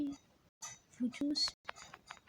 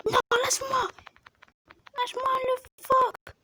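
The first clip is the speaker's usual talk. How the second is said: much higher, louder distressed protest; panicked high cry; 10 semitones higher, 6 dB louder than usual. Real, not acted. Girl crying out as a seal bites her shark, high panicked protest. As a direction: shriek for it to let go.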